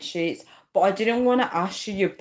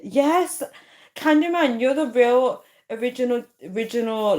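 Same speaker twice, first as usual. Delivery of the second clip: high, louder, thrilled; excited cheer; 5 semitones higher, 3 dB louder than usual. Real, not acted. Streamer cheering her nine-year supporter, high and excited. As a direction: cheer for your oldest fan.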